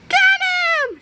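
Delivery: high, loud war cry; shout. A narrator's reading. Old ladies set the mob on Melvin, high shout. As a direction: shout it to launch a chase. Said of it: "shouted the old ladies".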